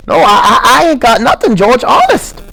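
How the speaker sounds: put-on high stammering childlike plea; Lenny, earnest. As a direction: insist like a scared child. Lenny's held voice.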